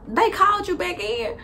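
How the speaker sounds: indignant questioning. Retold or imitated. Questioning Ben angrily.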